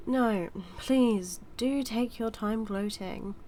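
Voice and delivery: loud, sarcastic invitation; emphatic mock politeness; exasperated sarcasm. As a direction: say it loudly, dripping with sarcasm. Sarcastic retort to gloating hero.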